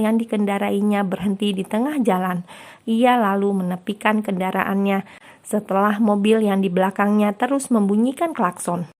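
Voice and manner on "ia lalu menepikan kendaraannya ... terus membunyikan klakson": usual speaking voice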